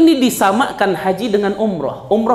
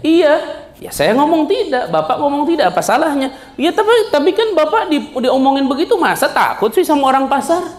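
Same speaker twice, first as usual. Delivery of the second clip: put-on a much higher, whiny boy's voice; protesting young son; 8 semitones higher than usual. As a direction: play the son pushing back at his father. Son argues with his father. (suara anak yang protes, nada jauh lebih tinggi)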